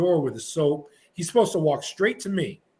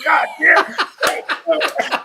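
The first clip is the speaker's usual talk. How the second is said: loud, high mock outburst, laughing; high-pitched exasperated cursing imitation; retold angry outburst; 11 semitones higher, 6 dB louder than usual. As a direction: erupt in mock fury, breaking into laughter. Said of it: "He gets so mad at me".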